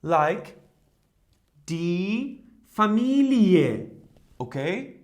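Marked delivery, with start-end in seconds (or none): 1.7-3.9 "Familie" slowly, ending as a separate ie-e; slow pronunciation demo. Shown as a demonstration. Teacher showing the other way to say ie, slowly. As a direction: open up the end of "Familie" slowly. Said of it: "pronounce it in a different way".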